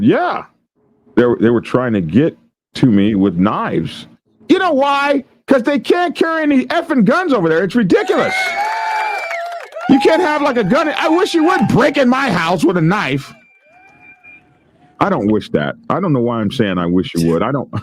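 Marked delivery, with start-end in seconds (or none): 4.5-13.3 high, cocky put-on voice; taunting dare. Streamer daring intruders to break in, high and cocky. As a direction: dare them to try it, mock tough. Taunting imagined knife attackers.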